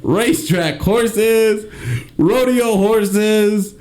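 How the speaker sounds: high, laughing TV host welcome; show host intro. Pretend. Mock horse show intro.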